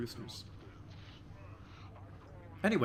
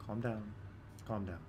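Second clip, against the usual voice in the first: soothing; 6 semitones lower, 9 dB quieter than usual. Real, not acted. Soothing a panicking rooster.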